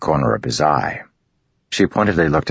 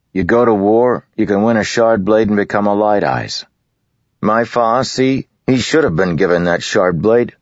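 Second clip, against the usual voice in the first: higher and louder, insistent lad; boastful farm boy; 7 semitones higher, 4 dB louder than usual. A narrator's reading. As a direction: push your story hard, a bit defiant. Jost boasts of father.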